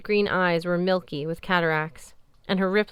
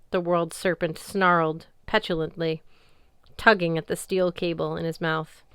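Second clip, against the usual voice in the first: usual speaking voice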